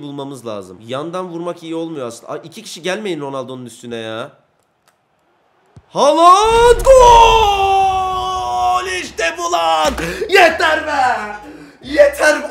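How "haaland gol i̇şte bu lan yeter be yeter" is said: very high, loud stretched goal cry; goal scream. Real, not acted. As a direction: erupt in triumph. Finally scoring a goal.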